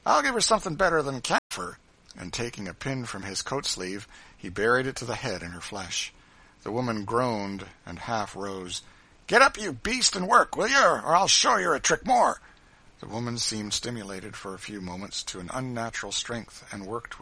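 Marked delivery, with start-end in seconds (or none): 0.0-1.8 loud, raised cruel driver's voice; gloating bully, pitched up; brutal grin. A narrator's reading. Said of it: "said the driver with a brutal grin". 9.3-12.4 loud, high bullying shout; fierce overseer's order; brutal command. A narrator's reading. Cruel driver orders fainted woman.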